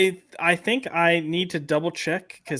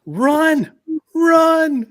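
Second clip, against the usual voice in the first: mock panic; 11 semitones higher, 6 dB louder than usual. Joking panic after trap quote.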